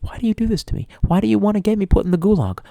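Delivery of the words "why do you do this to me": normal talking voice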